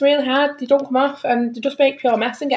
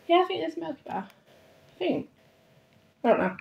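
much softer, as if checking to oneself; quiet unsure aside; 6 semitones higher, 11 dB quieter than usual. Real, not acted. Speaker unsure if it's Milky Bar, quietly to themselves. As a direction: drop the volume right down, uncertain.

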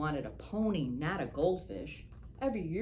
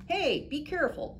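put-on high, louder call to the boys; shouted warning; 12 semitones higher, 4 dB louder than usual. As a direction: warn them across the street. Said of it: "Cynthia shouted".

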